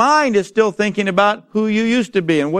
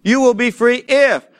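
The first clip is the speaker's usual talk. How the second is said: "if" held at the end, voice higher; stress on if; 5 semitones higher than usual. Shown as a demonstration. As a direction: lean on "will" and leave "if" hanging. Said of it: "Not if God loves me".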